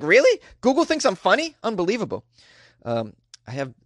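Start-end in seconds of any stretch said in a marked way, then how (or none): 0.0-2.2 high, incredulous "Really?"; mock disbelief. Host retelling his own reaction, playfully incredulous. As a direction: act amazed that Google finds him funny. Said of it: "I was like".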